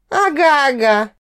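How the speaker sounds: a light giggle, drawn out; giggling. A narrator's reading. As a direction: giggle it teasingly. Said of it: "хихикнула я".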